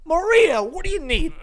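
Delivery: high, shouted Italian-American caricature; over-the-top Italian-American. Acted. Mocking a loud family food order, Italian-American shouting. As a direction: yell it like a hammy Italian-American. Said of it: "I'm not gonna do that".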